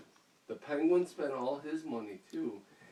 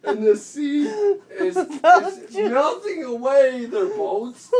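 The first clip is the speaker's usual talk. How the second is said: laughing storytelling; 9 semitones higher, 12 dB louder, slower than usual. Real, not acted. Telling story while cracking up.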